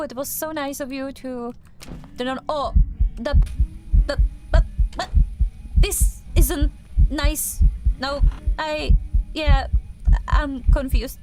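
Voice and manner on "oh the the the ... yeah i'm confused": loud, stammering alarm; real fright when the lights cut; startled fear. Real, not acted. Player startled by the ghost in the dark, loud and confused.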